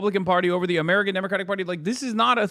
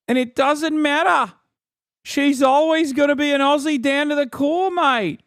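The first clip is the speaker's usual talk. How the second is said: high, loud caricature Aussie; mock Australian accent; 5 semitones higher, 3 dB louder than usual. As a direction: ham up a broad Australian accent. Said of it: "can't tell if it's you talking".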